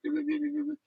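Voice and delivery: very high, soft squeaky beeping; mimicked chicken talk. Man imitates factory women talking like their chickens, high squeaky beeps. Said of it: "the women end up mimicking".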